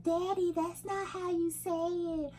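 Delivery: put-on high, drawn-out little kid voice; imitated child correcting. Mother voicing future kids correcting Daddy's accent, high mock child. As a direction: put on a kid's high, slow complaint. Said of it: "They'll be like".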